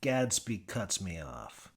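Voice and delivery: usual speaking voice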